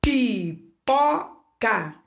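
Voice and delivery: PIPOCA very slow, pi... po... ca; split into syllables. Shown as a demonstration. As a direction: say Pipoca with a pause after each syllable. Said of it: "eu abri a boca".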